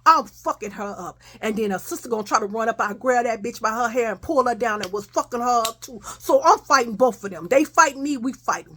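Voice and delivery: ordinary delivery, nothing marked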